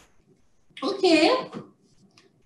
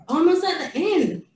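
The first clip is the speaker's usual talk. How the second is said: usual speaking voice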